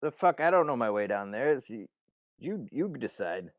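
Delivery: irritated retort. Acted. Steiny answering Simon from above, irritated. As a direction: call back in annoyance.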